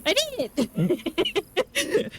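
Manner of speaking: high, slow celebratory squeal; thrilled, drawn-out cheer; giddy triumph. Real, not acted. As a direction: draw out a high, gleeful victory cry. Celebrating finishing the long task.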